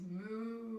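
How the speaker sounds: a lightly voiced mock moo; playful cow noise, not much pushed. Shown as a demonstration. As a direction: moo like a cow to amuse the baby. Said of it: "your cow noise".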